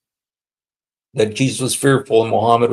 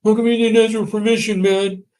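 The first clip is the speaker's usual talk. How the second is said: high, fast, cowering mock voice; terrified Muhammad; 11 semitones higher than usual. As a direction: squeak it like a frightened little man. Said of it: "terrified Muhammad was sitting there and said".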